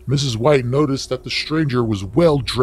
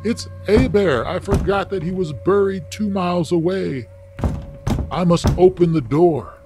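scream; 8 semitones higher than usual. Acted. Mother hears knocking and screams her son's name. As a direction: shriek it out as the knock comes.

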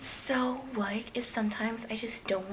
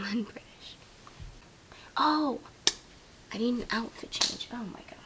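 ordinary talk

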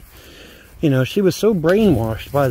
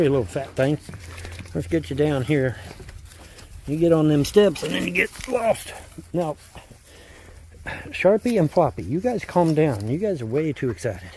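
ordinary talk, nothing marked

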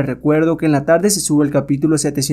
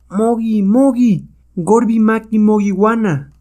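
high-pitched chant-like order, slowing at the end; witch's war command; 8 semitones higher, slower than usual. Acted. Witch orders her apes to attack, commanding.

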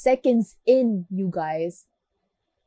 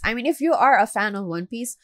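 ordinary talk

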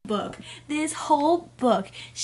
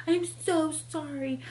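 higher, softer, slowed-down imitation of remorse; mock apology; 4 semitones higher, 4 dB quieter than usual. Reader imitating the hero's next-day apology, mock contrite. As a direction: put on a pleading little sorry voice.

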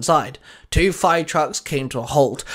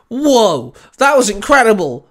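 amazed disbelief; 7 semitones higher, 7 dB louder than usual. A narrator's reading. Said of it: "Sam muttered in disbelief".

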